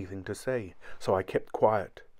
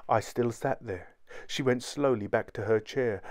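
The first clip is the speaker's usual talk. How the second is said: usual speaking voice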